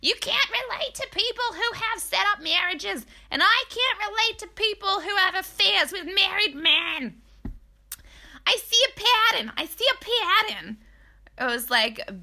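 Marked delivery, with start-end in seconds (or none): 0.0-10.8 high, loud mocking persona; Drunk Jen. Podcaster replaying Jen losing it at Margaret, mocking high voice. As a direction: commit to a very high put-on character voice. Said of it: "has this voice, so I'm going with it".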